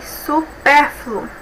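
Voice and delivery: su... PÉR-fluo, slowly parted; syllable by syllable. Shown as a demonstration. Teacher separating supérfluo for students, syllable by syllable. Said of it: "três sílabas".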